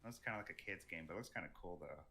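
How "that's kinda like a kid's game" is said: ordinary delivery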